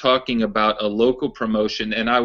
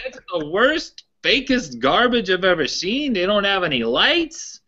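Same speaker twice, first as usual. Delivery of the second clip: put-on high-pitched Pittsburgh old-timer accent, disgusted; mock the old fan's Pittsburgh accent, high and scornful; Pittsburgh accent impression; 10 semitones higher than usual. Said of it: "I'll try to do his accent".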